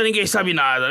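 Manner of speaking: ordinary talk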